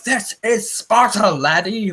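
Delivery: put-on Scottish-accented parody of the movie line; mock Scottish accent. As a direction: turn the Spartan cry into a Scottish brogue. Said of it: "it sounds that Celtic when he says it".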